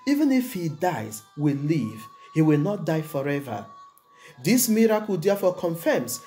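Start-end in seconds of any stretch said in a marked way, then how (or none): none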